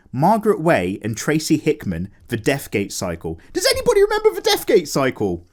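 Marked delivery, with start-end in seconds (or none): 3.5-5.5 high, excited call out to fans. Real, not acted. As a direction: sing out the question with glee.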